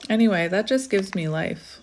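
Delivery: ordinary talk, nothing marked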